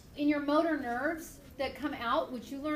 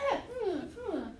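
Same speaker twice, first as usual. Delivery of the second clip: startled gasp; 5 semitones higher than usual. Retold or imitated. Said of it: "you go".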